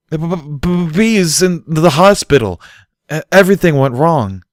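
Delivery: loud, high, slow stuttering panic; a foal panicking after a nightmare, loud stammer; terrified stammer. A narrator's reading. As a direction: stammer out the horror, shaking. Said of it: "Bucky stuttered".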